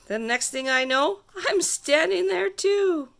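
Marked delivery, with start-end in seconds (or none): none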